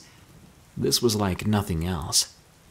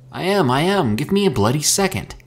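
high, louder, exasperated retort; lashing out; 7 semitones higher, 4 dB louder than usual. A narrator's reading. Mika lashes out at Herschel while turning dials. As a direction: snap back under pressure. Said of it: "Mika lashed out".

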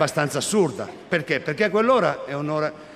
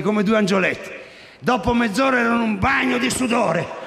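usual speaking voice

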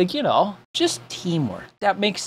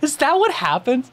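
normal talking voice

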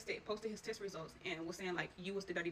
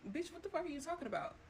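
higher pitched mock outrage; imitating an angry retort; 8 semitones higher than usual. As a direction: toss it back, incredulous and sharp. Retold angry retort to mom.

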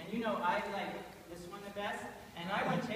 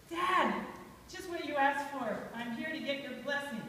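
raised pitch, calling out to Dad; loud hail easing into eager request; eager arrival; 4 semitones higher, 4 dB louder than usual. Acted. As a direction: burst in expecting your reward. Esau arrives eagerly for his blessing.

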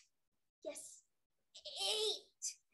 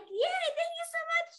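high, louder cheer; gushing high-pitched delight; excited thanks; 12 semitones higher, 6 dB louder than usual. Acted. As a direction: squeal the thanks with glee. Bella thanking John in told story.

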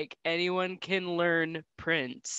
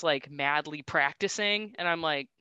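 ordinary talk

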